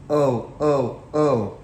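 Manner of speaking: groaning trucks; a loud, put-upon groaning chorus. Acted.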